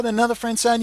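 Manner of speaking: usual speaking voice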